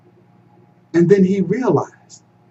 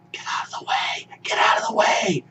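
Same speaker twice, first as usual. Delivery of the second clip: unvoiced strained whisper-shout; voice that won't carry; 6 semitones higher, 6 dB quieter than usual. A narrator's reading. Musa trying to warn family, voiceless whisper-shout. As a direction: try to shout but only a whisper comes. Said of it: "his voice wouldn't carry".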